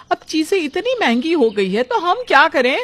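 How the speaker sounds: high-pitched, helpless protest; exasperated pleading. Acted. Wife defends herself to mother-in-law over high prices, exasperated.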